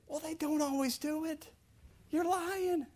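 high, soft little voice; mild rebuttal. Acted. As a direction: correct them in a small high-pitched voice. Answering the sweeping accusation.

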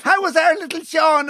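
high-pitched mock-mum question; imitated doting mother. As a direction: ask high, like a mum after her boy. Voices a mother asking after son.

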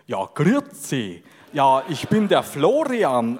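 slow, lightly put-on Swiss German grüezi; imitating a friend in a hammed-up Swiss accent; mock Swiss accent. Co-host mimicking Florian in a slow mock Swiss accent. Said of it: "mich gespielt".

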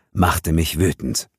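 ordinary delivery, nothing marked

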